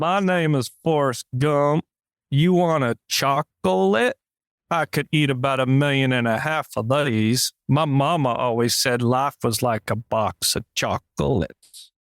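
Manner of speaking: slow Southern simpleton voice, words stretched out; Forrest Gump. Acted. Host retries his criticized impression for co-host, slow Forrest Gump drawl. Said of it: "your Forrest Gump accent was horrible".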